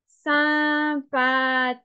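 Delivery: "sympathique" drawn out syllable by syllable; slow dictation. Shown as a demonstration. Teacher dictating "sympathique" for spelling, slow syllable-by-syllable delivery. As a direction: stretch each syllable of "sympathique".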